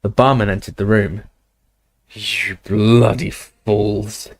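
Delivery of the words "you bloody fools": gruffly. Acted. Barman scolding the three teens, gruff and slow. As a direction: grumble it at the fools. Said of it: "he said gruffly".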